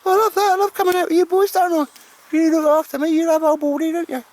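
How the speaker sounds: very high, loud squeaky pup voice; dog's voice. Pretend. Owner voicing his dog Baldrick to Al, high squeaky dog voice. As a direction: speak for the dog in a squeaky falsetto. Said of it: "He says".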